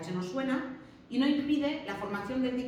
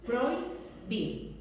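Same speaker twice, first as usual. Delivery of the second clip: "Prohibir" slowly as proi... BIR, stress on the last I; syllable by syllable; much slower than usual. Shown as a demonstration. Teacher shows students the diphthong, slow, split syllables. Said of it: "la fuerza de voz está en la segunda I".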